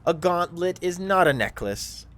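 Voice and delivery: loud, high scornful correction; scoffing. Acted. Drow corrects the gamer about the gauntlet, scoffing loudly. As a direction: scoff in exasperated disbelief. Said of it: "he kinda scoffs".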